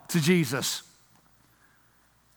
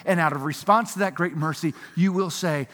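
usual speaking voice

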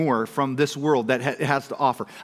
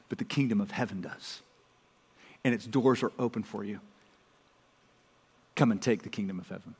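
softer, quieter voice of comfort; tender reassurance; 7 semitones lower, 6 dB quieter than usual. Acted. Pastor voicing God to the hurting, soft and tender. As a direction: gently draw the broken in, hushed and tender.

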